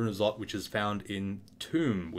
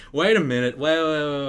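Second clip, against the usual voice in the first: loud, higher-pitched retold panic; panicked; 5 semitones higher, 9 dB louder than usual. Student stopping himself before freaking out over the exam, panicked. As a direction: act out catching yourself mid-panic. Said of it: "before we freak out".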